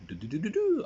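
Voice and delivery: high-pitched little pump sound; imitated pumping noise. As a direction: voice water chugging up a pipe. Imitates water being pumped uphill.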